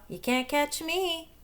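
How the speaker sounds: high, slow sing-song taunt; a cheeky little monkey daring the crocodile; teasing monkey. Acted. Monkey taunts crocodile, high cheeky sing-song.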